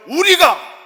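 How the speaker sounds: high, loud angry shout of 우리가. Acted. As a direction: bellow it like a furious leader. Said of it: "뭐라고 큰 소리를 내고 있어요?". ("우리가!" 하고 크게 외침)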